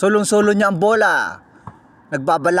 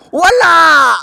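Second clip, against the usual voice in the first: loud, high cry of the miss; excited shout; 9 semitones higher, 8 dB louder than usual. Real, not acted. Commentator yelling as the three-pointer misses, loud and high. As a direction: shout it like the ball just rimmed out.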